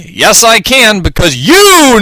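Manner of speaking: high, rising lawyer's accusation; dramatic accusation. A narrator's reading. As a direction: build up and point the finger at YOU.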